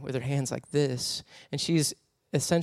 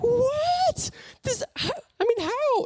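put-on much higher, squealing child's surprise; kids' amazed shout; slower than usual. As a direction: sound like a stunned little kid. Kids read the cake message.

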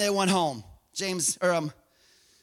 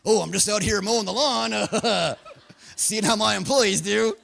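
undercover boss. Acted. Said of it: "This is the greatest undercover boss episode ever".

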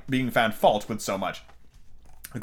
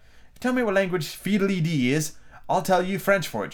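higher, mildly triumphant; spring the clever answer triumphantly; 8 semitones higher than usual. A narrator's reading. Alice finding a way out of difficulty, triumphant. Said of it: "she exclaimed triumphantly".

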